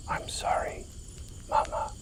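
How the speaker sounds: hushed, whispered apology. A narrator's reading. Little boy to his mother, looking at the stars, whispering. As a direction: whisper it very softly. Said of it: "he whispers".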